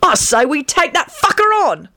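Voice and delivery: high-pitched eager rallying; excited, high and keen; enthused. A narrator's reading. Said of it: "he enthused".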